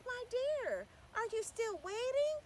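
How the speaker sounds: high, kind teacher asking gently; a sweet teacher checking on a child; teacher's voice. A narrator's reading. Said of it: "a teacher came out and said".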